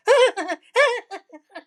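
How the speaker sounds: squealing laugh high up; shrieking real laugh; high-pitched burst of laughter. Real, not acted. Laughing at the memory.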